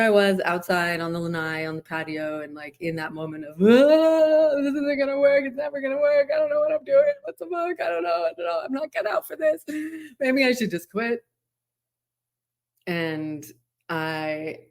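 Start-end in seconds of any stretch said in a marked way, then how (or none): 3.6-11.2 high, strained, despairing voice; despairing whine. Retold or imitated. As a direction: pitch up high and whine out the hopelessness. Reliving despair on the lanai.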